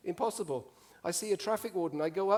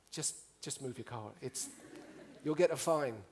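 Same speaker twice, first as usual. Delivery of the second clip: much softer, lower pleading; wife nervously urging him to move the car; uneasy quiet plea; 4 semitones lower, 5 dB quieter than usual. Retold or imitated. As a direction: hush him along nervously.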